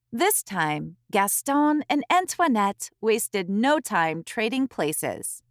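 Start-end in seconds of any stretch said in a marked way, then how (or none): none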